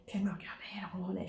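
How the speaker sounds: chuchoté vite. Real, not acted. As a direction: murmurer en racontant. Murmure rapide. (en chuchotant)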